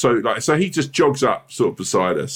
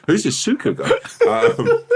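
giggling through the words; can't stop laughing mid-line; slower than usual. Real, not acted. Friends on a podcast, laughing through the story.

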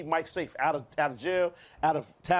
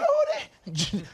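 yelled name, pitched way up; high call. Retold or imitated. Joking with friends, calling out sharply.

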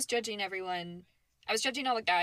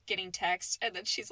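usual speaking voice

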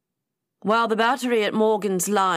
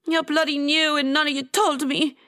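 higher-pitched angry accusation; indignant; 7 semitones higher than usual. A narrator's reading. Atia accusing her friends of hiding it, indignant. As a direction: accuse them hotly in character. Acted quarrel over kept secret.

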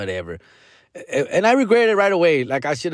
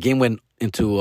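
ordinary delivery

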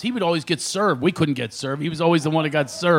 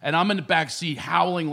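usual speaking voice

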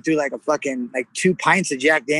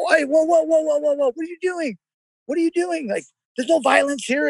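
much higher, mocking "whoa whoa" voice; mock whiny crowd; 10 semitones higher than usual. Imitating the crowd telling him to calm down, high and mocking. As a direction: squeak out the crowd's nervous pleading.

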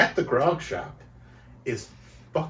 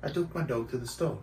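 soft, lightly faked British accent; 2 semitones lower, 12 dB quieter than usual. Shown as a demonstration. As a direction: echo it in a quiet British accent. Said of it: "Say something in a British accent".

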